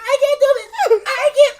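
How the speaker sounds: high, loud, strained cry of panic; panicked coworker Rich. Retold or imitated. Coworker melting down at the register, high panicked wail. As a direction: squeal it like a coworker cracking under pressure. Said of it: "That's exactly how his voice sounded".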